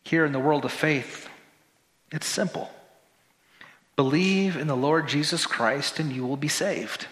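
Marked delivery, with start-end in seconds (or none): none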